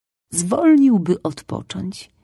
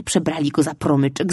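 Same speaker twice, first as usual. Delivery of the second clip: plain reading voice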